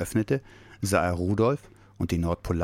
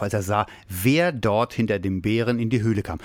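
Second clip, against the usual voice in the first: ordinary delivery, nothing marked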